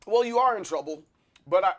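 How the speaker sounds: normal talking voice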